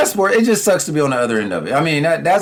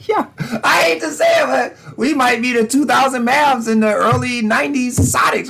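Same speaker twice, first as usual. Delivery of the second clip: laughing despair; 9 semitones higher than usual. Real, not acted. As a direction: cry it out through laughter, higher. Laughing despair about own team.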